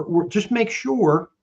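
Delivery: embedded command said slowly. Shown as a demonstration. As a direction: lift the voice on "make sure" as a command. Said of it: "I raise my voice".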